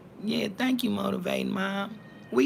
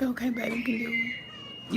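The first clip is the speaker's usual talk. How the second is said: tender mom; 5 semitones higher, 6 dB quieter than usual. Retold or imitated. Mom letting her son do the thumbnail, tender. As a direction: gently give in to your boy. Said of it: "And I'm like".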